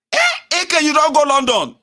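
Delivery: very high, loud exclamation; hammed-up high-pitched yell; acted shout. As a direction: shout it in mock amazement at your friend. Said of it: "why you dey shout?".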